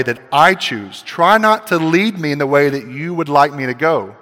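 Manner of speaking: loud, commanding rebuke of Jesus; forceful rebuke. Acted. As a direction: order Peter back behind you, full force. Preacher voices Jesus' rebuke.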